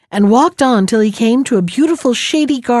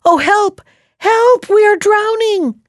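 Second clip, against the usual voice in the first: high, frightened cries for help; fluttering cries for help; 11 semitones higher than usual. A narrator's reading. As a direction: cry out, terrified of drowning. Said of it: "the answer came above the noise of the waves".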